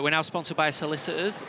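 usual speaking voice

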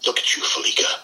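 raspy, half-whispered old coach impression; Lee Corso. Coach teasing a big eater at breakfast, husky imitated rasp. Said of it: "I'll give you my best Corso voice".

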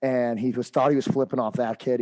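ordinary talk

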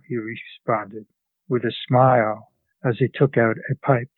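usual speaking voice

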